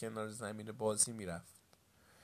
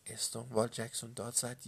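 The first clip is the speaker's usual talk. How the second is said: ordinary delivery, nothing marked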